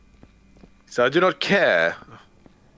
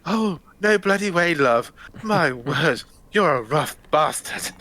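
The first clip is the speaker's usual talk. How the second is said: much higher, hammed-up, gasping; Peter O'Toole voice; 6 semitones higher, slower than usual. O'Toole giving up to Blessed, high hammed-up whine. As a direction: squeal and give in, theatrically. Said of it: "he's like".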